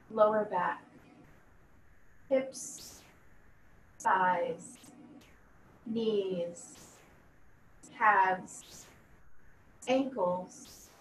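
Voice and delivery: slow, measured yoga nidra voice with long pauses; guided meditation. Acted. Yoga teacher leading students through a body scan, calm guided meditation. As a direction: guide them calmly, letting each body part settle.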